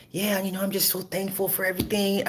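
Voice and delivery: high, soft mock gentle voice; mock Travis. Travis at the dinner table, high and soft. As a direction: imitate a soft-spoken dad. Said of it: "Travis is like".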